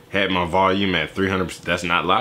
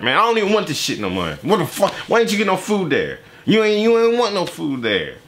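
mock annoyed rant; 8 semitones higher than usual. Mocking an annoyed boyfriend's complaint.

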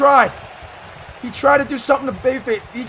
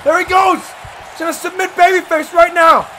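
high, loud commentary; excited play-by-play; 6 semitones higher, 5 dB louder than usual. Real, not acted. Commentator calling Billy's submission attempt, excited shouting. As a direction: shout the action as it happens.

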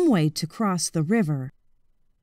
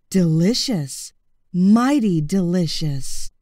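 slow, low crocodile savouring the taste; slow low satisfied crocodile; 3 semitones lower, slower than usual. Acted. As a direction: relish every syllable.

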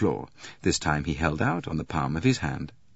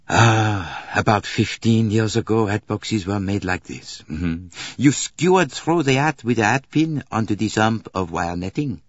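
louder, higher detective voice; Poirot, explaining; 4 semitones higher, 5 dB louder than usual. Acted. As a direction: play the detective explaining his discovery, emphatic. Poirot character demonstrating hat box.

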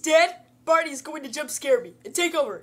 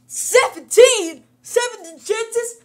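shocked disbelief; 6 semitones higher, 7 dB louder, slower than usual. Real, not acted. Outraged at losing chances.

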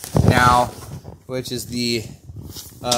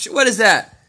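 higher, louder, incredulous questions; surprised; 9 semitones higher, 6 dB louder, faster than usual. Real, not acted. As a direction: catch your friend cheating. Shocked friend skipped candy.

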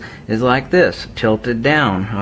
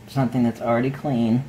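ordinary talk, nothing marked

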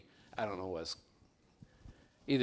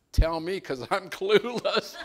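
laughing through the words; laughing quote; 8 semitones higher, 9 dB louder than usual. Retold or imitated. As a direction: giggle it out, helpless and baffled. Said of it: "And I'm like".

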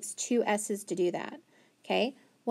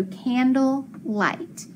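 "candle light" read slowly, each part clear; slow blended reading; 3 semitones higher, 3 dB louder, much slower than usual. Shown as a demonstration. Teacher blending the word slowly for the class.

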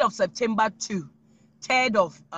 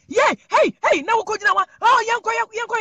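excited call; 6 semitones higher, 6 dB louder than usual. Acted. Hyped calling out.

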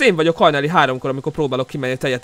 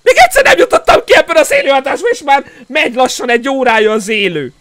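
exasperated complaint; 9 semitones higher, 9 dB louder than usual. Real, not acted. Frustrated complaint.

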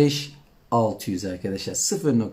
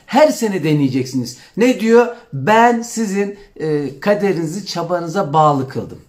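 fervent preaching; 5 semitones higher, 5 dB louder than usual. Acted. Fervent sermon-like encouragement.

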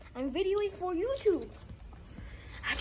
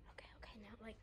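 whispering; 5 semitones lower, 25 dB quieter, much faster than usual. Real, not acted.

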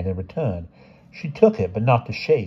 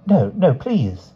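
afraid; 7 semitones higher, slower than usual. Acted. Frightened plea to fortune teller.

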